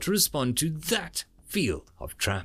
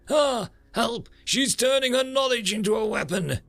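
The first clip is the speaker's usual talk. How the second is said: hammed-up cry for help, high; mock panic; 11 semitones higher than usual. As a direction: play-act being attacked. Mock panic while fleeing.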